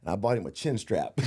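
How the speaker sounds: normal talking voice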